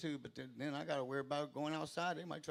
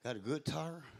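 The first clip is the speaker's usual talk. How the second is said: ordinary talk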